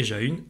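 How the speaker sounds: ja-une said again split as /ʒa-yn/; wrong reading. Shown as a demonstration. Teacher to child, repeating the wrong reading. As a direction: separate the A and U in ja-une. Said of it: "ça n'existe pas".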